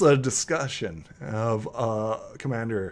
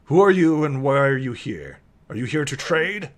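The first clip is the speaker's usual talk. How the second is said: demanding interrogation; 3 semitones higher, 6 dB louder than usual. Acted. Guard interrogates the newcomer.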